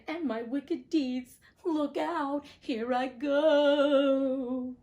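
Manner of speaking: dying witch giving her last words; melting witch. Acted. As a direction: let her voice melt away at the end. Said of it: "wailing, despairing voice".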